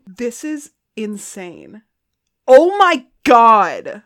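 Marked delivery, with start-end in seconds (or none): 2.5-4.0 awed gush. Real, not acted. Still awed by gift.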